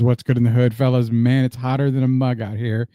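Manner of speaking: usual speaking voice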